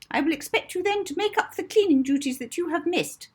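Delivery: put-on high, loud, fast bossy old woman; shrill-free clipped order voice of a stern matron. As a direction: bark orders like a strict headmistress. Miss Addiston ordering Molly.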